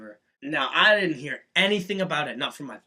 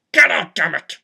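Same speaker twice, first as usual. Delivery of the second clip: louder, barked order to leave; retold angry yell; 8 dB louder than usual. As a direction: throw him out with a yell. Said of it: "I was like".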